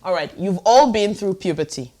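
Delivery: ordinary delivery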